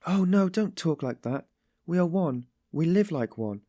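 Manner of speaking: high-pitched, indignant little protest; agitated, even angry. A narrator's reading. Sonia protests his talk, agitated and angry. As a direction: bristle like an angry little bird. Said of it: "agitated again, and even angry".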